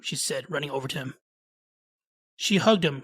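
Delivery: usual speaking voice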